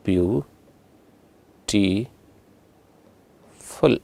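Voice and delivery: ಬ್ಯೂಟಿಫುಲ್ drawn out slowly as three separate syllables; split into syllables. Shown as a demonstration. Teacher splitting ಬ್ಯೂಟಿಫುಲ್ for students counting syllables, slowly syllable by syllable. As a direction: say ಬ್ಯೂಟಿಫುಲ್ in three slow pieces with long pauses. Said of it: "ಬ್ಯೂ ಒಂದು, ಟಿ ಒಂದು".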